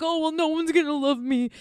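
high mopey whine; imitating someone moping that nobody will love them, whiny; whiny mock self-pity. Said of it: "Don't sit there and be like".

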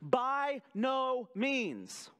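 "By no means" drawn out very slowly, each word weighted; Paul flatly refusing a false idea; emphatic denial. Retold or imitated. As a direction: lay down a firm no, word by word. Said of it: "his response is".

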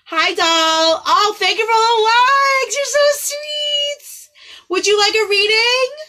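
high, loud gushing hellos; excited greeting. Real, not acted. Greeting viewers who just joined the stream, high and excited. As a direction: squeal a welcome to friends joining.

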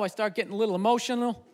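normal talking voice

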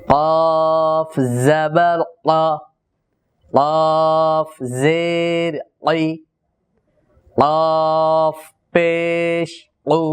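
drawn out very slowly, letter then vowel then sound: কফ জবর ক; slow spelled-out qaf drill. Shown as a demonstration. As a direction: spell it out, stretching each part very slowly. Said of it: "তাহলে উচ্চারণটা কেমন হবে?".

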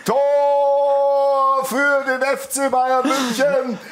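slow, drawn-out stadium goal announcement; long goal yell. Acted. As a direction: stretch every word like a stadium announcer. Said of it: "Stefan Lehmann".

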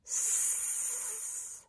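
"S" hissed alone, no vowel; isolated s. Shown as a demonstration. Teacher isolates the first sound of see. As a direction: hiss just the "S" sound. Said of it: "what's the first sound you make?".

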